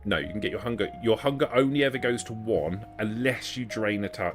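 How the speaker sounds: ordinary delivery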